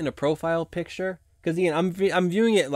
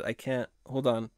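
ordinary delivery

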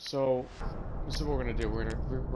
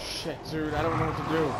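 panicked; 4 semitones higher, 5 dB louder than usual. Real, not acted. Surrounded by enemies in game.

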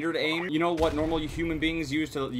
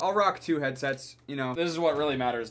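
ordinary talk, nothing marked

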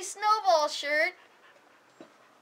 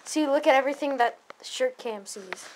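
ordinary talk

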